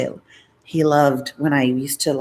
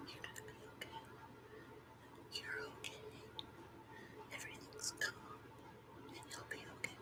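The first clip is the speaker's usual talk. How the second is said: soft unvoiced soothing whisper; ASMR whisper; 28 dB quieter, faster than usual. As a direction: reassure them in a hushed whisper.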